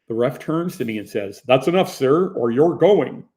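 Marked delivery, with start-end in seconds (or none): 1.5-3.3 stern warning. Retold or imitated. Ref warns angry player.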